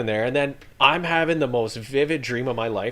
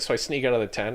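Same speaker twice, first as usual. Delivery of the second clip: normal talking voice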